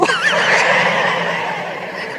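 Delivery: high unvoiced squeal of laughter; a sharp, high breathy giggle. Real, not acted. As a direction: let a thin squeal of laughter out. Laugh at fake necklace story.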